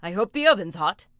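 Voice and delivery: loud booming ogre. Acted. Hungry ogre ordering his wife to roast sheep, booming. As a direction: boom out your demands like a giant.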